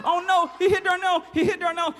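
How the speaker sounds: high, loud laughing alarm; oh no, hammed-up shock; shocked gasp. Storyteller reacting to the boy hitting the duck, high and alarmed.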